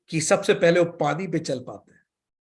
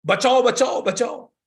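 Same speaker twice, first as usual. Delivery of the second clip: mock shout for help, higher; yelling for rescue from the river; cry for help; 6 semitones higher, 3 dB louder, slower than usual. A man caught in the river calls to villagers, hammed-up yell. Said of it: "Mulla Nasruddin चिल्लाने लगे".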